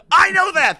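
very high, loud retort; acted exasperated shout. A narrator's reading. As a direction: snap back at full pitch. Acted retort, older man.

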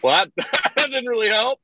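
high, laughing disbelief; amused high-pitched complaint. Real, not acted. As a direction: laugh through the words. Laughing at useless advice.

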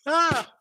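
a long, high yawn sliding out; big yawn. Real, not acted. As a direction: let a tired yawn escape mid-sentence. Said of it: "excuse me for my yawns".

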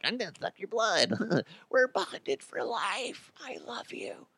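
soft, high, slow swooning lover; mock romantic fading to a whisper; breathy mock lover. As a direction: coo it like a dreamy blood-bonded sweetheart. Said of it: "be like".

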